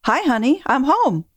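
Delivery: breeze in like nothing happened, all sunshine; sunny greeting. Retold or imitated. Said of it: "said in a sunny voice".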